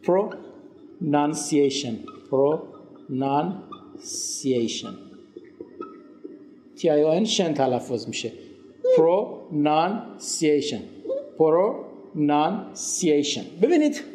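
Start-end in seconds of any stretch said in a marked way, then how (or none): 0.1-4.9 very slow PRO-NUN-CI-A-TION, broken by pauses; "pronunciation" drawn out slowly in separate chunks for learners; syllable by syllable. Shown as a demonstration. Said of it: "Repeat after me please". 9.0-13.4 "pronunciation" very slowly, chunk by chunk; drawn-out PRO-NUN-CI-A-TION with clear gaps; syllable by syllable. Shown as a demonstration. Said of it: "Repeat after me please".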